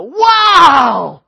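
huge high-pitched shouted WOW; full of emotion. Shown as a demonstration. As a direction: blow them away with amazement. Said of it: "there's emotion".

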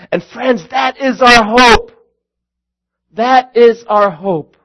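slow, high emphatic hope; proclaiming hope. Real, not acted. Preacher to the congregation, slow emphatic proclamation. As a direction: lean on our hope, slowly.

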